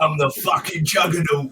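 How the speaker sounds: loud high laughing villain shout; Juggernaut voice. Retold or imitated. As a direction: yell it like a hammy Juggernaut. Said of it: "impression of the worst Vinnie Jones".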